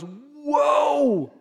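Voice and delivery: sudden high shout of alarm; startled whoa. Retold or imitated. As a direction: yelp as the waves catch his eye. Peter startled by the waves.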